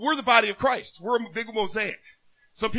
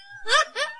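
a quick high chuckle; high laugh. Real, not acted. Preacher laughs at arguing with God, quick high laugh.

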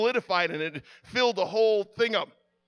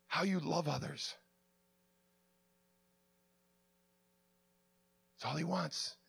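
much softer, intimate sermon close; soft closing appeal; 2 semitones lower, 8 dB quieter than usual. Acted. Preacher closing the message to the congregation, soft and intimate. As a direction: draw them in quietly and earnestly.

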